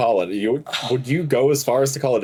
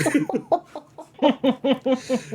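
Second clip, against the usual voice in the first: high laugh; 13 semitones higher than usual. Real, not acted. Laughing at own story.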